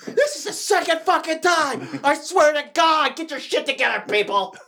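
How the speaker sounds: high, loud furious boss yell; angry boss. Pretend. Pretend manager yells at workers, angry. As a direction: yell at the staff over a botched order.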